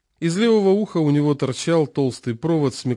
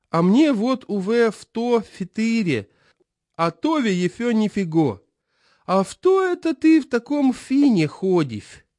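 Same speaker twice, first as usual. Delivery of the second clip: put-on toothless old man; 9 semitones higher than usual. A narrator's reading. Chairman voiced.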